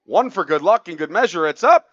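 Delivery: rising excited sports call; live play-by-play. Acted. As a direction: build to a loud shout. Calls the last play live.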